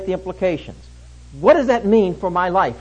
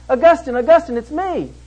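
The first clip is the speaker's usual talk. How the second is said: higher-pitched woman's call from behind; 7 semitones higher, 5 dB louder than usual. Retold or imitated. Old girlfriend calls to Augustine from behind, calling out. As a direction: imitate someone hailing an old lover in the street.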